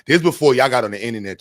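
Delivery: normal talking voice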